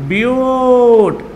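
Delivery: very slow, stretched syllables; drawn out. Shown as a demonstration. Teacher stretching the root word slowly for students. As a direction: stretch it out for the class.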